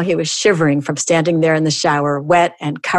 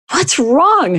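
mild mock shriek; 8 semitones higher than usual. A narrator's reading. Wife to husband screaming in the shower, mild shriek. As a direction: read her shriek lightly, voice jumping high. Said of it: "she shrieked".

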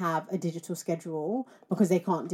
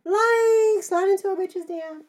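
high, bubbly put-on American accent; 12 semitones higher than usual. To her co-host, slipping into a put-on American accent. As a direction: play up a mock American accent. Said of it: "I'm really into my American accent".

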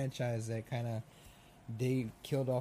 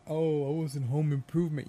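mocking boast; 4 semitones higher, 5 dB louder than usual.

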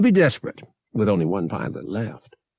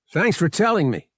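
high, sarcastic retort; yelled retort; 8 semitones higher, 3 dB louder, faster than usual. A narrator's reading. Pilot to an unhearing captain, yelled retort. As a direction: snap back at the captain. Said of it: "Han yelled".

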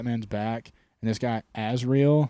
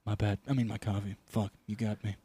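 low quiet Bruce Wayne; 4 semitones lower, 8 dB quieter than usual. Retold or imitated. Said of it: "Bruce Wayne".

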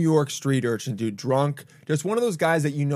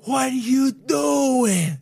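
Sebastian Maniscalco impression; 8 semitones higher, much slower than usual. Retelling the drunk man in Maniscalco style, high exasperated shout. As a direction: wail it like an outraged Italian-American comedian. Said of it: "literally Sebastian Maniscalco".

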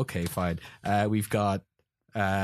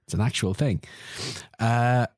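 ordinary talk

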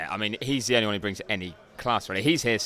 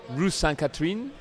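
higher-pitched put-on French pronunciation; careful French flourish, pitched up; best French accent; 7 semitones higher than usual. Shown as a demonstration. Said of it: "That's my best French".

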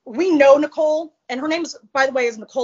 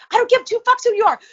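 defiant; 10 semitones higher, 3 dB louder, faster than usual. Retold or imitated. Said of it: "'cause I was like".

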